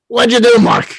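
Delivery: high, loud Brooklyn exasperation; Larry King, Brooklyn accent. Acted. Late mentor questions his protégé, exasperated Brooklyn accent. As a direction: demand it in a put-on Brooklyn voice. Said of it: "in his Brooklyn accent".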